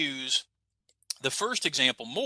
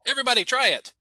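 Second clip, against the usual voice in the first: ordinary talk, nothing marked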